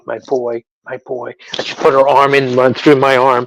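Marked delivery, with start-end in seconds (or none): 0.1-1.3 soft, dropping almost to a murmur; tender mother. Retold or imitated. Mom drawing her son close, hushed and tender. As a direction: murmur it fondly, quieter on the repeat. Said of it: "she changed, Jekyll and Hyde".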